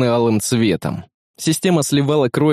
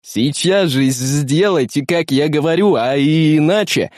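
slow, higher threat with pressure; pressed, insistent; 3 semitones higher than usual. A narrator's reading. Technician forcing the doctor at gunpoint, slow insistent threat. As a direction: lean on each drawn-out word. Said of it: "с нажимом произнёс техник".

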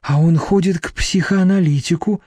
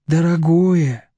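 usual speaking voice

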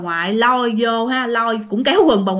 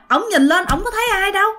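ordinary delivery

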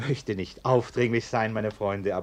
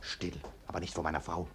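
hushed hurry; 4 semitones lower, 11 dB quieter, faster than usual. Acted. Hiding it from his wife.

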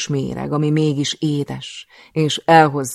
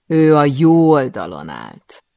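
mocking word; 10 dB louder, slower than usual. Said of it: "A jó szót gúnyosan ejti ki".

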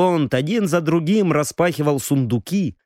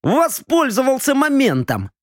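put-on higher, boastful drunk young noble; tipsy young lord; 10 semitones higher than usual. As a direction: talk like a proud tipsy heir. Viscount boasts.

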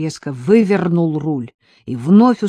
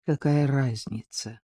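low, soft, slow retort through the teeth; understated, tight-jawed retort; through clenched teeth; 4 semitones lower, 7 dB quieter than usual. A narrator's reading. Maleev answers his companion's outburst, through clenched teeth. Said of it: "произнёс сквозь зубы Малеев".

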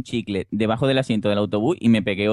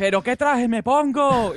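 silly high voice; 12 semitones higher, slower than usual. Acted. Acting out a clown's question.

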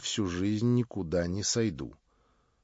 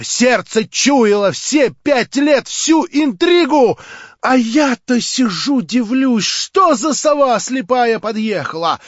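much higher, louder, proud; Marya, defiant; 11 semitones higher, 13 dB louder than usual. A narrator's reading. Marya scorns her would-be prince, high and loud. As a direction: cry it out with haughty scorn.

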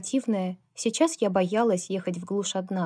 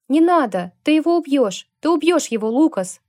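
desperate plea; 7 semitones higher, 7 dB louder than usual. Acted. She grabs Lukas, pleading.

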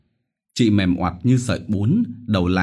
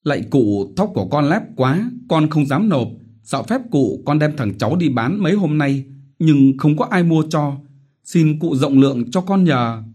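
higher, humble begging; pleading; 8 semitones higher, 4 dB louder than usual. Acted. Widow Vít begging the chief for mercy, pleading. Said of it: "sụp xuống đất lễ hắn".

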